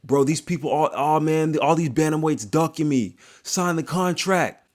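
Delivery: high-pitched mock complaint; high put-on griping, slowing at the end; imitating the fighter. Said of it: "he was like".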